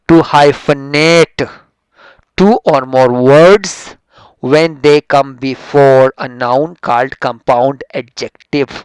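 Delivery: very slow, loud, word by word; slow dictation. Shown as a demonstration. Teacher dictating the rule to the class, slow and loud. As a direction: read the rule out slowly so students can write. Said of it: "reading the rule slowly to class".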